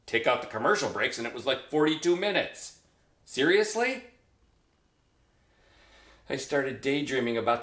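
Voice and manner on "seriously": high, rising note of disbelief; can-you-believe-it question; incredulous. Acted. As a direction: ask it high, as if it can't be true. Disbelief at one-hour cures.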